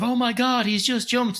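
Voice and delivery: high-pitched, hammed-up horror; mock shocked outcry. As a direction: imitate a horrified onlooker, pitch shooting up. Mocks shocked onlookers after a jump.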